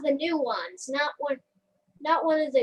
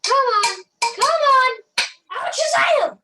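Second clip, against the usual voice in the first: loud, high magician's yelling; hammed-up frantic spell shouting; exasperated shouting; 8 semitones higher, 10 dB louder than usual. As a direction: shout desperate commands at the wand. Yelling at failing wand.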